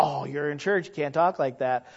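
ordinary delivery

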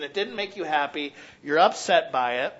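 usual speaking voice

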